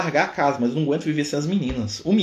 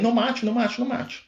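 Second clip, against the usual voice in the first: higher, mildly acted frantic begging; desperate plea; 10 semitones higher than usual. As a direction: daughter begging her father not to kill, mock desperate. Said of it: "acorda gritando, desesperada".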